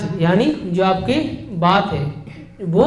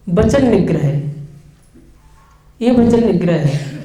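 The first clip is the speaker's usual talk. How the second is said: usual speaking voice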